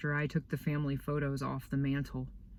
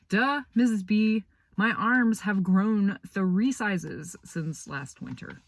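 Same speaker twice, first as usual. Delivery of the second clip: sarcastic duh; 4 semitones higher, 5 dB louder than usual. Retold or imitated. Sarcastic kid replies.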